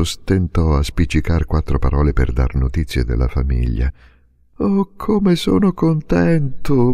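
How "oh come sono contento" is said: stammered joy. A narrator's reading. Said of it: "balbettò".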